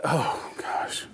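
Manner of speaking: whispered exasperated sigh of a dad; hushed, acted dismay. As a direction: mutter it under your breath, despairing. Father's dismay at clumsy son.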